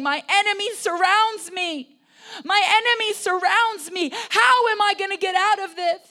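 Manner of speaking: loud, high cry for rescue. Acted. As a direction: cry out as if surrounded by enemies. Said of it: "you hear him crying out".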